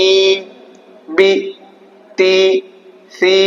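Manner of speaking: ই, বি, তি, সি each held long on a level tone; separate, sustained syllables ই, বি, তি, সি; slightly drawn-out vowels. Shown as a demonstration. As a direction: stretch every vowel in ই, বি, তি, সি for clarity. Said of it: "তার জন্য অল্প টানছি".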